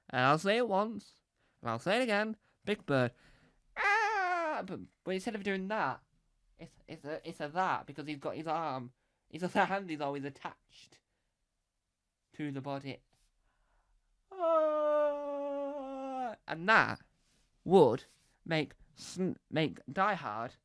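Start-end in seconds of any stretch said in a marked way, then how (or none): 3.8-4.6 falling scream. Acted. Villain falling again. 14.3-16.3 falling scream. Acted. Villain falling.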